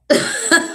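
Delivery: high, bursting laugh; short, high-pitched cackle of amusement; loud laugh. Real, not acted. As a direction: burst out laughing at your own joke. Laughs at own aside.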